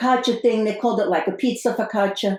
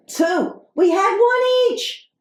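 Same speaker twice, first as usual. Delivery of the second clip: incredulous complaint; 8 semitones higher, much slower than usual. Real, not acted. Complaining about two tater tots.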